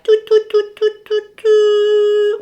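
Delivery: high, sing-song alarm toots. Retold or imitated. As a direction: toot like an alarm going off. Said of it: "my spidey senses were like".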